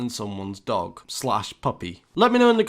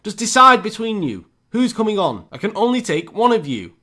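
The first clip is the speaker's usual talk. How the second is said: higher, louder fed-up order; exasperated driver; 6 semitones higher, 4 dB louder than usual. Retold or imitated. Bus driver fed up with the arguing pair, exasperated loud voice. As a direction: lose patience and bark at them. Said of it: "And the bus driver goes".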